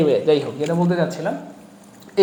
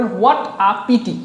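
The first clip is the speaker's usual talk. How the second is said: very slow read; much slower than usual. Shown as a demonstration. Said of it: "মনোযোগের সাথে খেয়াল করবেন".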